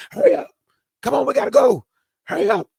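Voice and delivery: mock rushing parent. Dad hurrying his late daughter down the stairs, high urgent call.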